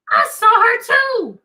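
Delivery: high, slow eager townsperson; eager witness. Acted. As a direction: blurt it out like an excited villager.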